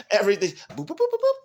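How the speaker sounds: very high, loud bleeping boops; playful squeaky boop sounds; mock machine beeps. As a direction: beep like a machine powering up. Mimics systems switching back on.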